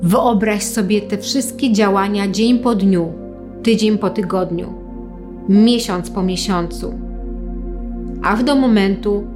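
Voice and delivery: calm them with long pauses. Acted. Leads a guided visualization.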